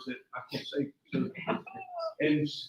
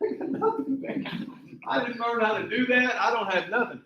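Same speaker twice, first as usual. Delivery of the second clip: much higher, pleading; high helpless protest; 8 semitones higher than usual. Retold or imitated. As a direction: plead ignorance, pitched up. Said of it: "his own retold reply".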